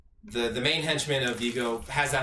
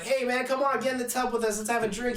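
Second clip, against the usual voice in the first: lightly voiced Yusuf calling him in, much higher; breezy, coaxing invitation pitched way up; mock party invite; 10 semitones higher than usual. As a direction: coax him into the tub, playfully. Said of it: "Yusuf was like".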